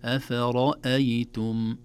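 أفرأيتم recited slowly, all letters thin except a heavy ra; correct thin letters. Shown as a demonstration. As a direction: say أفرأيتم with only the ra made heavy. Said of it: "هكذا". (ترقيق الحروف مع تفخيم الراء)

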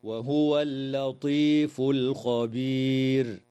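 slow chanted tajweed recitation of الخبير with heavy R; Quran recitation. Shown as a demonstration. Sheikh demonstrating the verse ending to students, slow chanted recitation. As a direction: intone the verse slowly, melodically. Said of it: "هكذا".

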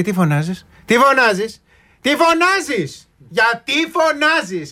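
rising, slow mock mother scolding; imitating mothers. Imitating how mothers scolded children, shrill slow mock scolding. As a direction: ham up a nagging Greek mom. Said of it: "Σου λέγε".

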